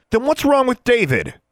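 high, loud demand; heated, indignant question; angry challenge. A narrator's reading. Heated quarrel with her brother.

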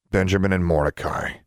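ordinary delivery

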